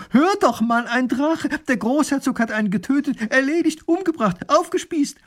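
excited calling out. A narrator's reading. Wife bursting in with news for husband, excited. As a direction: pile up the words in mounting excitement. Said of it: "ruft sie ihm schon von Weitem zu".

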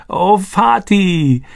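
high, drawn-out tearful cry. A narrator's reading. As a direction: call out to him through tears. Reunion with father.

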